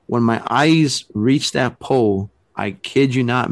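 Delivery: ordinary talk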